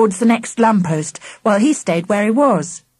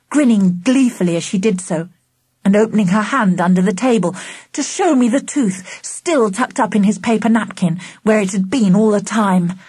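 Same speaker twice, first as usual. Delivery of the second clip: usual speaking voice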